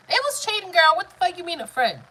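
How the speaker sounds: high, loud, incredulous comeback; indignant rebuttal. Real, not acted. Answering a viewer who calls it just a friend, indignant. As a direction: fire back in outraged disbelief.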